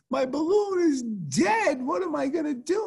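put-on high, slow wail of a sad little girl; imitating a crying daughter; crying child. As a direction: whimper it like a heartbroken kid. Daughter voiced crying again.

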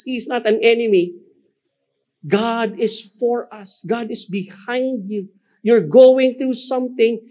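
high, ringing declaration; full-force preaching. Acted. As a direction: proclaim it to lift the room.

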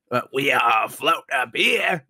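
slow, dry, blank-voiced operator; deadpan balloon man. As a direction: state it like a bored balloon pilot. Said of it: "the guy just turns around and goes".

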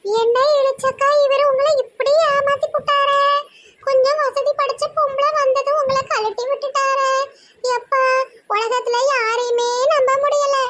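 very high, drawn-out crying voice; tearful wailing. Acted. Friend lamenting the manager's betrayal, high tearful wail. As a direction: wail it like a hammed-up jilted woman. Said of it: "சும்மா சும்மா அழுதுகிட்டு இருக்காத".

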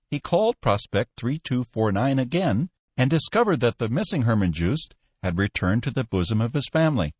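usual speaking voice

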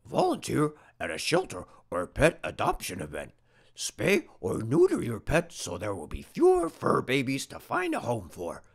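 ordinary delivery, nothing marked

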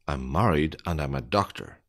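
usual speaking voice

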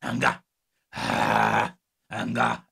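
anger said as aŋga, deep and low; accented anger. Shown as a demonstration. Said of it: "repeating the word anger".

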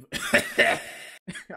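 breathy burst of laughter; laughing. Real, not acted. Laughs at own accent attempt.

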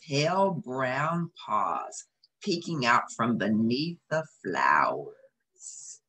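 ordinary delivery, nothing marked

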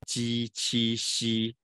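鸡七吸 slow and even, each syllable held; drawn-out teacher's recital of 鸡七吸; tongue-surface drill. Shown as a demonstration. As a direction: model 鸡七吸 in a level tone, palatal consonants. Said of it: "一起念就更明显了哈".

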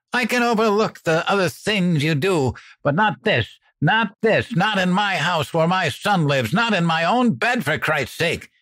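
high, loud outraged yell. A narrator's reading. Mother draws the line with her partner, furious yelling. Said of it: "Mom yelled".

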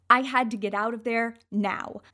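usual speaking voice

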